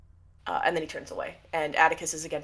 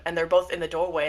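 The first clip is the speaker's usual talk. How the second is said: usual speaking voice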